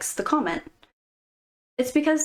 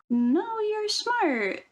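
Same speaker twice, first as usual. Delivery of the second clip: higher friend, rising to a squeak on smart; mock reassurance; 10 semitones higher, much slower than usual. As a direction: lightly play the obliging friend.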